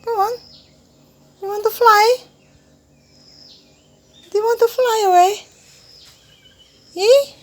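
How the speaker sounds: high, sweet coaxing voice to a pet. Real, not acted. Coaxing a bird to fly.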